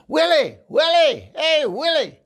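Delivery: very high, loud mock call; calling Willie. Stranger hollers up at the tattoo, high and loud. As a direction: holler at the tattoo like an old pal. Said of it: "he started going".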